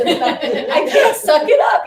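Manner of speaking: high, loud, slow mock wail; uncontrollable crying. Traveler unable to stop tears at customs, comic wailing. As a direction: blubber helplessly, half laughing. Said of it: "It was uncontrollable".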